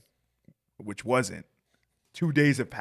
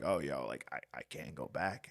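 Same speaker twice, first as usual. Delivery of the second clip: lower, softer, drained and weak; weak sick voice; 9 semitones lower, 7 dB quieter than usual. Retold or imitated. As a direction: sound too sick to come in. Sick, telling manager he can't work.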